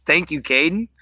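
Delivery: usual speaking voice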